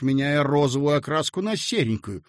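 ordinary delivery, nothing marked

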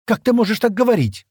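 indignant, high and loud; angry cry. A narrator's reading. Шира defending Стэн from accusation, crying out angrily. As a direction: cry out in outrage, pitch leaping up. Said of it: "гневно крикнула Шира".

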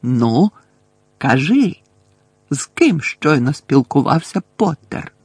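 loud, insistent questioning with pauses; forceful prodding; pressing interrogation. A narrator's reading. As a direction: demand an answer impatiently. Umbridge interrogating the girl.

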